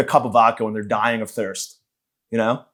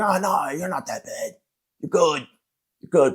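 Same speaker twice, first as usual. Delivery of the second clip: mock consoling; 4 semitones higher, 4 dB quieter, slower than usual. Consoling a sad guy.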